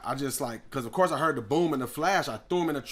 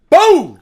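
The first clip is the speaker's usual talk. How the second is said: sudden shouted boom; explosive mock gunshot; loud gunshot boom; 13 semitones higher, 21 dB louder than usual. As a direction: blast it out like a gunshot. Gunshot sound in story.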